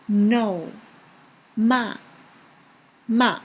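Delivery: "No" and "Ma" loud, dropping sharply; very strong falling tone. Shown as a demonstration. Teacher likening fourth tone to no, strong falling "Ma". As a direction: hit "Ma" hard and let it fall, like a firm "No". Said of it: "very strong".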